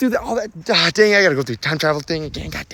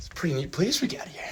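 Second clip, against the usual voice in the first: whisper; 9 dB quieter, faster than usual. Acted. Arriving at the time safari.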